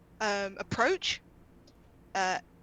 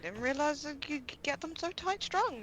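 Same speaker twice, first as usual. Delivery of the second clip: taken-aback guard; 5 semitones higher, 7 dB quieter than usual. Acted. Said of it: "The guy's just like".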